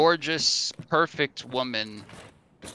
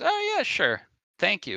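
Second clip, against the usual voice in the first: pleased Jarvis; 9 semitones higher than usual. Acted. Jarvis accepts drink.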